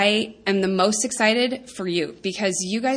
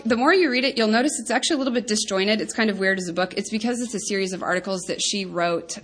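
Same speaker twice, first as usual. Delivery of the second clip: usual speaking voice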